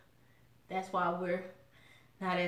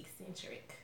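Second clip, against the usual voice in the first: husband's word; 16 dB quieter than usual. Retold or imitated. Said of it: "as my husband would say".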